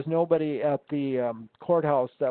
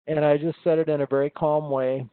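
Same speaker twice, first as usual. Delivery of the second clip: ordinary talk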